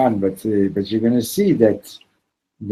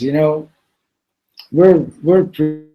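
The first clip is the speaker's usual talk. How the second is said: higher, homespun country voice; folksy villager; 7 semitones higher, 7 dB louder than usual. Retold or imitated. Gravedigger talking to the preacher, mock folksy and higher. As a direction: play the simple village man.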